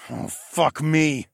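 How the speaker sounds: slow, drawn-out grumble; exasperated grumble. A narrator's reading. Narrator seeing the destroyed bridge, slow grumble. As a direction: drag the curse out, fed up. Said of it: "I grumbled".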